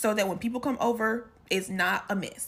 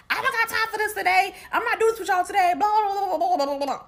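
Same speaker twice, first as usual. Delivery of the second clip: high, loud, fast ranting mom; mom going off; 9 semitones higher, 5 dB louder, faster than usual. Retold or imitated. Imitating mom going off about the holiday, loud high rant. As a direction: rattle off an exasperated mom's tirade. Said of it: "My mom goes off".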